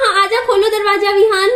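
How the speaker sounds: mother calling her son to open the door. Real, not acted. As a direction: call through the door to the little one. Calling to child behind door.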